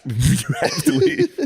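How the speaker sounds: high, slow, mock stern; mock professor. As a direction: imitate the professor sending him out. Professor ordering him out.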